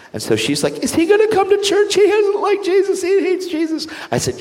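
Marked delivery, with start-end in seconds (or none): 0.8-3.9 very high mother's voice; imitating a worried mother on the phone; worried mom. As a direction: voice the anxious mom.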